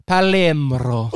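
slow, loud Palermo with a botched R; Simeon's mispronounced Palermo. Shown as a demonstration. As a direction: stretch out Palermo loudly, fumbling the R. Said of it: "make Simeon say Palermo correctly".

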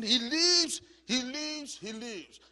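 high exultant shout, fading on each repeat; retold joyful shouting. Preacher recalling a man shouting after the miracle, joyful shout tapering. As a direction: start loud and high, then let it drop away. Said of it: "he was just shouting".

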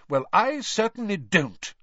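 a lightly voiced pompous older brother; sanctimonious. A narrator's reading. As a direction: look down your nose at them. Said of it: "said Percy sanctimoniously".

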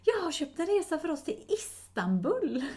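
happy husband. Retold or imitated. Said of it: "Och han är så glad".

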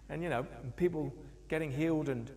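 normal talking voice

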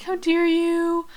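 high, loud, drawn-out indignation; mock outrage. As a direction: stretch it out like an offended heroine. Acts out an offended Bella.

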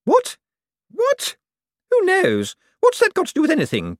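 high, loud haughty woman; Poochie's voice. Acted. To Cosmo, a greedy high-pitched rich woman.

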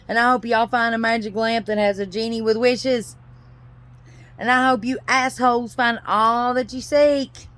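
loud, rousing sign-off speech; rhymed toast. Acted. Podcast host toasts listeners at show's end, loud and rousing. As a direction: raise a glass and rally the listeners.

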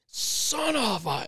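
high, drawn-out startled cry; mock shouted half-curse; retold startled yell. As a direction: act out a long yelp of shock. Retold shock at cockroach on leg.

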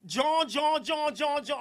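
high, loud drumming of "John"; mocking rapid repetition. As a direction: pound out "John" over and over, teasing. Mocking how often Philly says it.

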